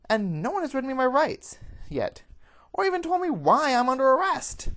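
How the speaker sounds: high, loud, outraged fox woman; full-voiced, high-pitched ranting; yelling like a New Yorker. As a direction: shout your indignant protest at the guards. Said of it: "yelling like a New Yorker".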